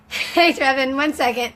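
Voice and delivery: real shout to the neighbor; calling out. Real, not acted. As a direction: call out to get Trevin's attention. Calls out to her neighbor.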